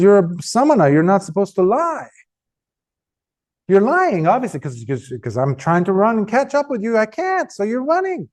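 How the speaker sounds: high, accusing storyteller's retort; indignant protest. Retold or imitated. Angulimala accusing the Buddha of lying, high indignant protest. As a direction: argue back in exasperation, pitch climbing. Acted protest of frustrated pursuer.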